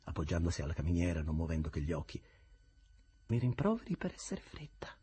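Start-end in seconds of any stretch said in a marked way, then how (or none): 3.3-5.0 whispered, cutting retort; cold whisper. Acted. Estella retorts to Havisham in a cold whisper. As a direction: hush it with icy scorn.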